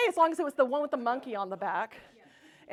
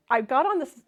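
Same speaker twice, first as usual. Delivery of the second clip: ordinary delivery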